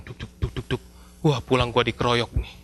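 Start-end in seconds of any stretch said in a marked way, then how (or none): 0.1-0.8 soft imitated thumping heartbeat. Nervous boy imitates his pounding heart, soft. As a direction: tap out a nervous heartbeat softly. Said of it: "saya deg-degan".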